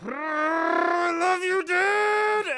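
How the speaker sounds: high, slow, loud wailing cry. Acted. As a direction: howl it out through tears. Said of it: "tears start streaming down his cheeks".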